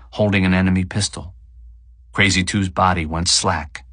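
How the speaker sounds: ordinary delivery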